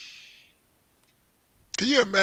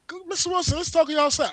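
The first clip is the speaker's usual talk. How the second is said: higher imitation of a polite officer; mock cop voice; 11 semitones higher than usual. Officer coaxing him outside, higher mock sweetness.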